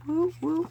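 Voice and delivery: high double "woo woo"; light woo-woo of progress; little celebratory whoop. Real, not acted. Small cheer on reaching twenty.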